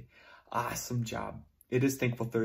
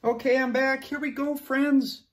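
bright and loud; 11 semitones higher, 6 dB louder than usual. Real, not acted. Teacher back, bright story intro.

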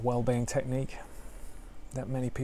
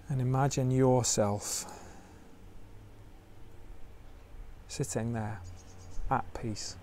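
guided meditation; 4 dB quieter than usual. Acted. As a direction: ease listeners into stillness gently.

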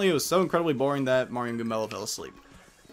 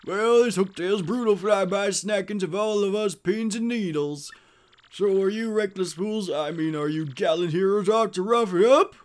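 animated, higher-pitched mayor; folksy village mayor; 6 semitones higher, 4 dB louder than usual. Acted. Village mayor warning Mario about the dragon Hooktail, animated. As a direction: play a chatty small-town mayor.

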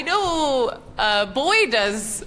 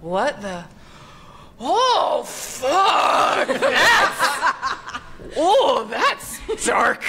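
shocked reading; 4 semitones higher, much slower than usual. Retold or imitated. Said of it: "I was like".

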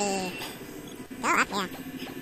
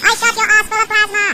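louder, higher gloating brag; gloating taunt; 5 semitones higher, 7 dB louder than usual. Real, not acted. Gamer taunting a killed enemy, gloating loud. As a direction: crow over the kill.

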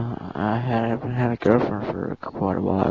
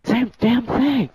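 frustrated whine; 13 semitones higher, 5 dB louder, slower than usual. Real, not acted. Caught by the prank.

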